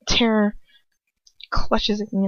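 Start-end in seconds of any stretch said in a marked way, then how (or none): none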